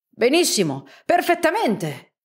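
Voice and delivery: delighted cry, voice jumping high on perfettamente; excited exclamation. Acted. Uncle exclaims in triumph to the professor, excited. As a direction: burst out in triumph. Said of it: "esclamò lo zio".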